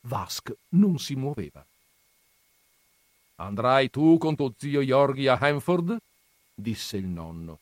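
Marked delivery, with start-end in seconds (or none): 3.4-6.0 lightly voiced scornful old grandfather, raised; gruff grandfather. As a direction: scoff at the books' dreamers. Grandfather asks Vask. (nonno burbero accennato, tono sprezzante)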